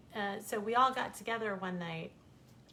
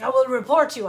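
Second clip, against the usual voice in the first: shouted, higher indignant threat; loud threat; 5 semitones higher, 15 dB louder, faster than usual. Retold or imitated. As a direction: threaten him at the top of your voice. Cameraman threatens her.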